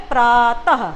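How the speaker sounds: प्रातः drawn out, ending in a clear "ha"; slow प्रा, then "taha" in प्रातः; breath-h ending. Shown as a demonstration. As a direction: sound the final ह of प्रातः. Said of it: "इसका उच्चारण बच्चों ह के जैसे होता है".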